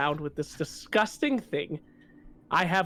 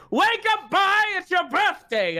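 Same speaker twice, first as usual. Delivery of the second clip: mock wake-up shout; 9 semitones higher than usual. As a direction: yell him awake, hammed-up. Shaking the son awake.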